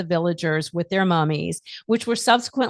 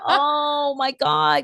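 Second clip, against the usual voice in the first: drawn-out oh my god; 6 semitones higher, much slower than usual. Real, not acted. Gushing about the show.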